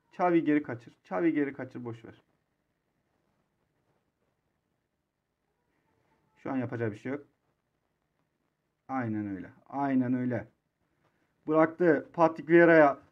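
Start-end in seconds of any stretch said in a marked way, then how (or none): 0.1-10.5 resigned. Real, not acted. Resigned after danger.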